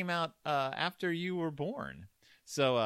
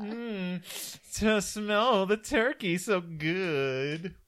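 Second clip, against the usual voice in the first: high, slow, louder mmm of delight; savoring a smell; 6 semitones higher, 5 dB louder, much slower than usual. Pretend. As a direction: savor the turkey smell slowly. Pretending it is Thanksgiving.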